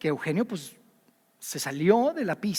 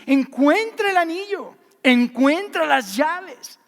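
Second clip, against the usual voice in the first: slow, louder "Encuentra el anillo, encuentra las llaves" with each word pressed; firm insistence; 7 semitones higher, 6 dB louder, slower than usual. Retold or imitated. Preacher voices the fiancé insisting slowly and loudly that she find it. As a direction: drag out "encuentra" as a stern demand.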